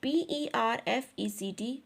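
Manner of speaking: ordinary talk, nothing marked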